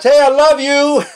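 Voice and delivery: calling a friend's greeting across the parking lot; yelled across a parking lot. Shown as a demonstration. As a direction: shout it to someone far across the lot. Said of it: "I yell it across the parking lots".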